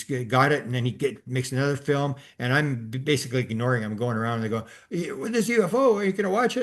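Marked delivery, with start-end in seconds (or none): 4.9-6.6 high-pitched imitation of excited relatives; mock urging. Imitating family urging him to watch the UFO, mock urging. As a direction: pester him in a raised, squeaky voice.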